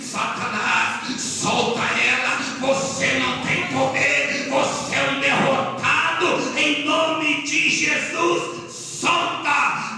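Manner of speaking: commanding deliverance cry; exorcism command. Retold or imitated. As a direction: order the devil out word by word. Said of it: "botei a mão na cabeça dela e falei".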